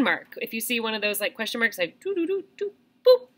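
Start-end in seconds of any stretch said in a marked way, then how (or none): 2.0-3.3 high sing-song "doo doo doo, boop"; little high-pitched boops; imitated doo-doo boop noise. Shown as a demonstration. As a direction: sound out a squiggle and dot, up high. Imitating question mark noises.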